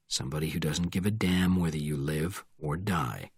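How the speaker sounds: low, soft menace; quiet threat. A narrator's reading. Bourne threatening the frightened driver, low quiet menace. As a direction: threaten without raising the voice.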